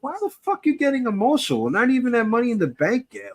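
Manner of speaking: mock exasperation. Host replays his reaction to the emotional promo, high and incredulous. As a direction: pitch it way up in exasperated disbelief. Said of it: "and I was like".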